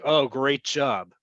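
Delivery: slow, drawn-out sarcastic praise. Shown as a demonstration. Teacher gives the English equivalent, sarcastic. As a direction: stretch out the fake compliment. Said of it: "it could also be sarcastic".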